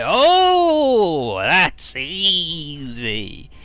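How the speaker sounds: high loud oh, then slow easy; breezy drawn-out oh. Acted. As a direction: toss it off as too easy. Eiji answers with breezy swagger.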